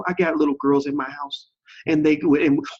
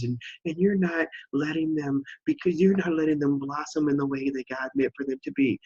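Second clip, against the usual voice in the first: mock coddling; 4 semitones higher than usual. Host mimicking people who say he's too mean, mock coddling. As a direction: imitate an overprotective critic, pitched up.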